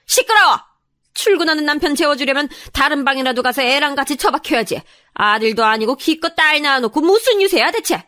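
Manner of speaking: furious mother-in-law voice; sharp tongue-lashing. A narrator's reading. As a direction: silence her and tear into her. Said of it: "시어머니는 제게 날카롭게 쏘아붙이셨어요".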